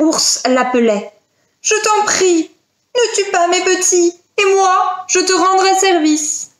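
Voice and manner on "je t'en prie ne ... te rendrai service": high-pitched, imploring bear voice; high animal mother bargaining for mercy; pleading mother bear. Acted. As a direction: beg for your cubs. Mother bear begs for cubs.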